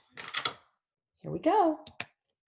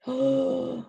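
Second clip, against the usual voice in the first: gasp; 5 semitones higher, 4 dB louder than usual. Acted. Surprise at next animal.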